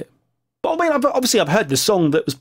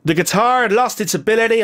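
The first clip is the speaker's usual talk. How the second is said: put-on American accent; 7 semitones higher than usual. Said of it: "he would have said it in an American accent".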